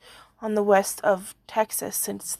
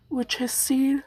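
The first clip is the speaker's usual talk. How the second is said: yawning; 3 semitones higher than usual. Real, not acted. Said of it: "oh my God, I'm so sorry. I always yawn".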